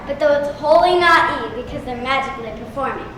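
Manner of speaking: ordinary talk, nothing marked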